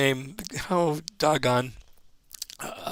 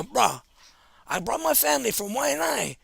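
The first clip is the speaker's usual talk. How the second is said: high-pitched Pidgin-voiced complaint; upset local guy; 7 semitones higher, 5 dB quieter than usual. Comedian imitating an upset local fan, high and pleading. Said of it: "this one guy go".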